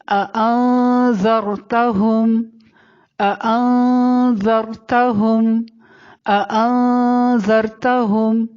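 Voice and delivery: اانذرتہم very slow, n held in the nose; ikhfa recitation. Shown as a demonstration. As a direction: stretch the nasal hum in اانذرتہم. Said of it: "آواز کو ناک میں چھپا لیتے ہیں". (اانذرتہم ٹھہر کر، نون ناک میں چھپا کر)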